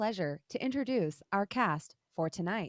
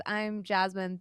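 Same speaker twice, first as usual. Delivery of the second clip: ordinary delivery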